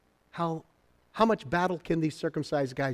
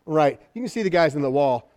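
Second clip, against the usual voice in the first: ordinary talk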